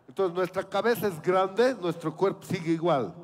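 ordinary talk, nothing marked

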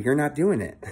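ordinary talk, nothing marked

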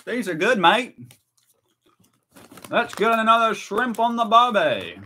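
high, hammed-up Aussie accent; mock Australian accent. Streamer tasting Australian sweets, hammed-up mock Australian accent. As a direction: ham it up as a cartoon Australian.

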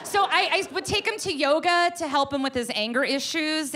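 ordinary talk, nothing marked

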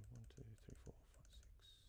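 fading into a whisper under the breath; quiet counting. Real, not acted. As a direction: count to yourself, dropping to a whisper. Counting wood under his breath.

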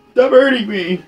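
high, loud pained cry; pained plea. Real, not acted. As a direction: beg it to stop hurting. Pleading while getting hit.

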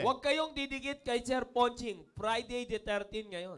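slow, deliberate read-aloud; reading a comment. Retold or imitated. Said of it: "Ano sabi?". (mabagal na pagbasa ng babala)

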